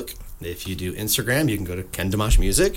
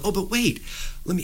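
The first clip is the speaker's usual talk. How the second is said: higher, coaxing "oh but wait"; eager bum pleading; 9 semitones higher, 6 dB quieter than usual. Retold or imitated. Bum coaxing the upset little girl, higher and eager. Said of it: "the whole show was him going".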